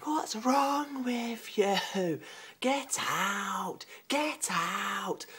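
imitating a young girl, high and drawn out. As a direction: drag it out like a sulky teenage girl. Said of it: "Like a young sort of girl saying".

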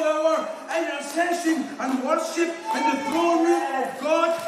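high, driving revival preaching. Real, not acted. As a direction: preach it like you feel it. Preaches at full force.

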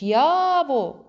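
high, loud drawn-out call; irritated shout. A narrator's reading. Boy calling his missing friend, irritated shouting. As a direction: yell the name, losing patience. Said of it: "роздратовано кричу я".

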